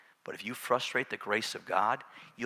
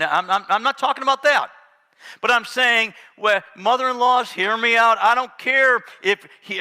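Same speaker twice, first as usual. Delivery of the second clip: booming sermon delivery; full-force preaching; 8 semitones higher, 10 dB louder than usual. Acted. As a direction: preach it out to the whole church.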